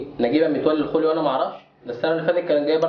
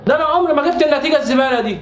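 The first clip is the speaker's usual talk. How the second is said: high, raised complaint; exasperated scolding; 8 semitones higher than usual. Acted. Father berating his son over the grade, exasperated. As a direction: scold him in high disbelief.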